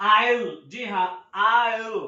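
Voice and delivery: I'LL said as one syllable; stylish contraction. Shown as a demonstration. Teacher models the stylish short form for learners, contracted I'LL. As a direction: run I and will together as I'LL. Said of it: "style से हम बोलते हैं".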